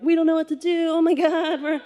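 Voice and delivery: high, frantic and helpless; mock panic, voice up high; panicked kid. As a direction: squeal like a scared twelve-year-old. Panicked kids in mudslide.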